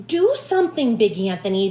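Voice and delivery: shout. Acted. Townspeople beg Big Anthony to fix it, shouting. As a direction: yell for help at him. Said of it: "they shouted".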